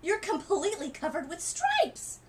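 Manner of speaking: high, loud frightened exclaim; mother panicking over her daughter's stripes; alarmed cry. Acted. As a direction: shriek it at the child's skin. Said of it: "she cried".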